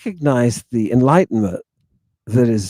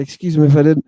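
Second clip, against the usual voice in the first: usual speaking voice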